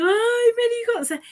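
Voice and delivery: exaggerated high, gushing overreaction; imitación emocionada. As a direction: play someone melting at a compliment. Moved person overreacting to "te quiero. (voz muy aguda y exagerada de alguien conmovida)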